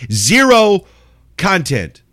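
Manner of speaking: ranting verdict, Zero stressed; emphatic zero. Real, not acted. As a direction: hit Zero hard and pause. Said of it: "Plain as the nose on my face".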